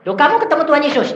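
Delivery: high, loud, quick question; re-enacted excited disbelief; acted surprise. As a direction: fire the question back in astonishment.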